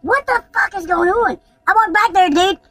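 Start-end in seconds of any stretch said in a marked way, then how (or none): 0.0-1.4 shocked. Retold or imitated. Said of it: "And I'm like".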